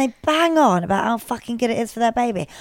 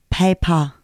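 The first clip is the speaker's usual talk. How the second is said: mock Nigerian accent; 4 semitones lower than usual. Shown as a demonstration. Host demonstrating accent hack, mock Nigerian accent. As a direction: lower "Paper", second syllable opened to "pah". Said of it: "this is the Nigerian".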